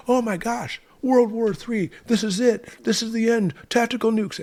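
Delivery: high, breathless mock doom; mock panic. Mocking how fear channels talk, in hammed-up panic. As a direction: ham up a panicking newscaster, pitched way high. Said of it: "And that's how they talk".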